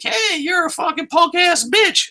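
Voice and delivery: very high, mock taunting voice; hammed-up squeaky call-out; Manic. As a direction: imitate a challenger's insult, way up high. Acts out Manic calling Storm out.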